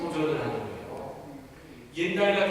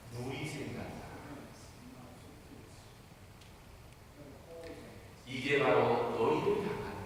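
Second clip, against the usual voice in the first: solemn Jesus; 6 semitones lower, 7 dB quieter than usual. Acted. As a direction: reveal the wounds as a hushed confession. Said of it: "뭐라고 하시는지 아세요".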